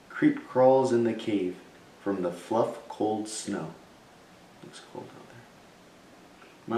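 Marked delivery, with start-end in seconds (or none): 4.6-6.8 slow hushed aside, no voice; a slow secret whisper to the listeners. A narrator's reading. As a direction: whisper it slowly, peeking out at the snow. Narrator aside peeking outside.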